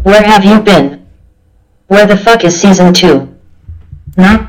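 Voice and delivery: loud furious demands; angry shout. Acted. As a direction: yell at the podcaster. Angry listener demanding season two.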